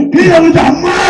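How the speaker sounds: high pained cry of Amma; yelling for mother. Retold or imitated. Husband falls at temple, cries out loud. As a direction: cry out as if you just fell on the temple stones. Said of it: "அப்படின்னு கத்துறேன்".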